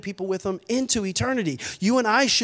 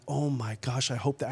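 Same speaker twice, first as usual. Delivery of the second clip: low, soft murmur to himself; hushed hope; 7 semitones lower, 8 dB quieter than usual. Retold or imitated. Father thinking he sees his lost daughter, hushed hope. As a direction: barely dare to hope, low and quiet.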